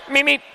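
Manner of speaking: high, shouted cartoon road runner meep. Announcer teasing rider Gonzalez, shouted cartoon meep. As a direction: toot it out like a cartoon speedster. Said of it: "You could be a Speedy Gonzalez".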